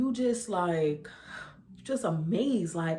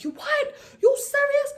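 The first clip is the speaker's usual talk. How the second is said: much higher, squealing with laughter; excited shriek; 3 dB louder than usual. Retold or imitated. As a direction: squeal it like a thrilled sister. Sister hearing pregnancy news.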